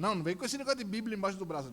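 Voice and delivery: quick, loud, high rebuke; irritated order. Retold or imitated. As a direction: cut her off, annoyed and fast. Father orders daughter off.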